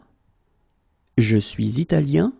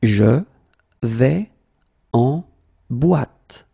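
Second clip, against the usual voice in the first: slow, word by word; much slower than usual. Shown as a demonstration. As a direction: pause after every word. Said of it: "teacher drills word by word".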